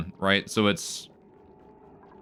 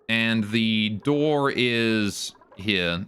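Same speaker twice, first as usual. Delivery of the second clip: slow; much slower than usual. Real, not acted. Very slow explanation.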